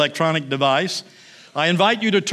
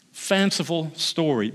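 normal talking voice

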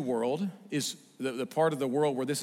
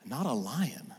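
hushed wonder; 5 dB quieter, slower than usual. Retold or imitated. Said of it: "He says".

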